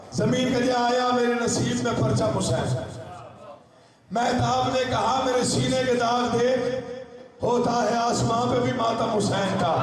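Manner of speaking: high, sustained recitation pitch; declaimed elegiac verse. Acted. Moon answering Earth with its scarred chest, high declaimed recitation. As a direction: declaim each half-line high to rouse the gathering.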